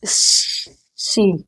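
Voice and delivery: सी said softly as a hissed "see"; soft letter name. Shown as a demonstration. Teacher modelling the letter सी for students. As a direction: breathe the सी out gently. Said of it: "इसको हम क्या बोलते हैं".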